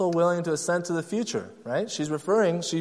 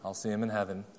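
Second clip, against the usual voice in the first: lower, much softer rote recital; automatic response; 7 semitones lower, 6 dB quieter than usual. Retold or imitated. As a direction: toss it off like a memorized Sunday school answer. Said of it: "She had an automatic response".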